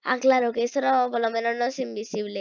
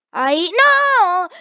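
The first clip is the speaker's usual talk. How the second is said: sudden high, loud cry of dismay; frustrated yell; 6 semitones higher, 9 dB louder, much slower than usual. Real, not acted. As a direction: yelp as the jump fails. Fails a jump. (grito de frustración)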